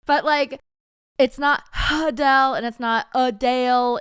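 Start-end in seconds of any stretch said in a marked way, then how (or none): 1.2-2.5 [name] with an exaggerated breathy HUH start; loud, stressed huh-DELL for [name]; wrong pronunciation. Shown as a demonstration. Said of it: "It's just [name]". 2.7-4.0 [name] exaggerated as uh-DALE; loud uh-DALE for [name]; wrong pronunciation. Shown as a demonstration. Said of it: "It's just [name]".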